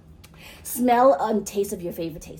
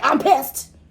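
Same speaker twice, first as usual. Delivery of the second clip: a louder, higher imitated I'm pissed; mock angry outburst; 6 semitones higher, 9 dB louder than usual. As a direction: fake a burst of anger for the point. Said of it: "we're not be like".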